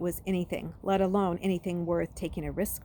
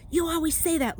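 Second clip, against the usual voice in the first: higher, louder grumble; sulky complaint; grumbling; 11 semitones higher, 5 dB louder than usual. Acted. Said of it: "Luke grumbled".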